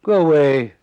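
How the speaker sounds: loud, haughty "Go away"; pompous brush-off. Retold or imitated. Arthur shooing fans from the train window, loud and haughty. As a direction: shoo them off like an irritated grandee. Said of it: "Arthur tapped on the window and".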